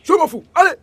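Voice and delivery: loud scornful order; loud dismissive. Acted. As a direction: brush them off loudly. Général chasse les gardiennes. (ordre méprisant et fort)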